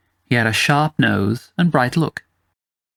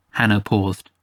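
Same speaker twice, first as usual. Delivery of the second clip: usual speaking voice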